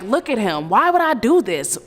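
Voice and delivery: mock desperate excuses; pleading husband. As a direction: build into desperate denial. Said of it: "things anybody would say".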